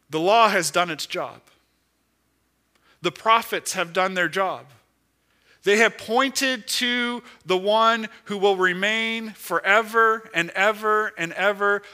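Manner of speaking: loud, high, driving sermon build; full-force preaching. Acted. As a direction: preach it out to the back pews, loud and urgent.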